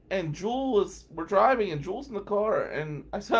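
ordinary delivery

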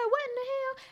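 high, startled what in the hell; shocked disbelief. Retold or imitated. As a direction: pop up in outraged surprise. Reacting to Ralph laughing.

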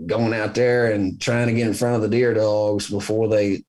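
usual speaking voice

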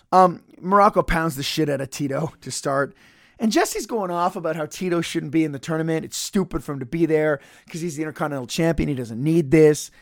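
ordinary talk, nothing marked

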